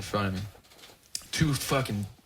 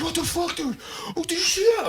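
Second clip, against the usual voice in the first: much higher, wild-eyed disbelief; mock shocked friend. Friend just missed by a car, squealing much higher in shock. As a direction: yelp it like you just dodged death.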